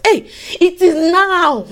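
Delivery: ordinary talk, nothing marked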